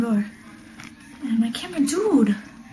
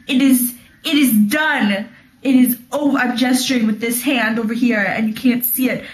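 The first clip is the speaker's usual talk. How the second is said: usual speaking voice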